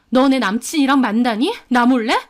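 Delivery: indignant, accusing questions; angry. A narrator's reading. Woman asking rival about her boyfriend, angry. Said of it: "저는 화가 나서".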